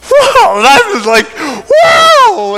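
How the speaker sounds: very high, very loud cries of wonder; overwhelmed with awe, shouting it out; thrilled shout. Retold or imitated. Eagle flies right past him.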